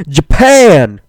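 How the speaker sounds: loud, high excited shout; mock-thrilled exclamation, drawn out. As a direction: yell it like an overjoyed local. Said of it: "how they all say it very excitedly".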